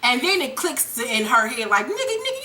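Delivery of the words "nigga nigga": high, softer acted disbelief; skeptical. Tasha realizing Ghost is playing her, skeptical. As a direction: pitch it up as if catching him out.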